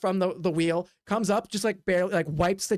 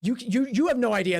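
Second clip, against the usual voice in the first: usual speaking voice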